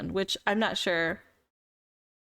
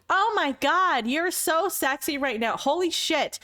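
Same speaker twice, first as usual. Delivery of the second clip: gushing hype; 7 semitones higher than usual. Retold or imitated. Girls hyping each other up.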